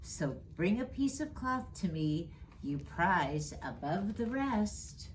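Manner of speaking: ordinary delivery, nothing marked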